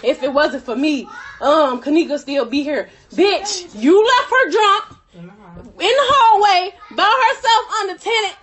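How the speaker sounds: ordinary delivery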